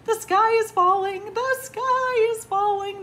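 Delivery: very high, loud chorus-like alarm; panicked crying out. A narrator's reading. Chickens in a tizzy tell everyone, crying out. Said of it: "they cried".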